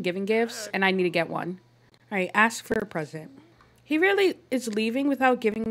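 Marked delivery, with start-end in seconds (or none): none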